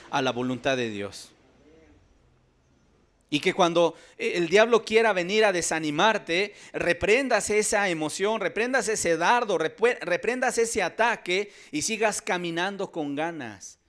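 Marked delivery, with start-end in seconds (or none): 3.3-13.8 loud, high preaching push against the devil; rally the church to rebuke the devil; full-force preaching. Acted. Preacher urging the congregation, loud and rising.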